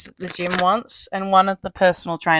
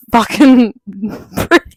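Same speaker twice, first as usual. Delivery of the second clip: put-on British accent; 7 dB louder, slower than usual. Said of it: "the British person in me comes out".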